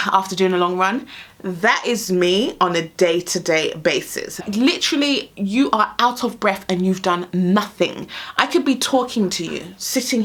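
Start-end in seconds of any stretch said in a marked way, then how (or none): none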